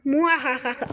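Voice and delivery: villain cackle muajaja; gleeful evil laugh; mustache twirling villain. Pretend.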